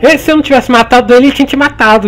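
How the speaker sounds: high, loud arguing back; heated protest. Real, not acted. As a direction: defend yourself heatedly.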